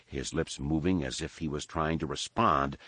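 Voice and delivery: plain reading voice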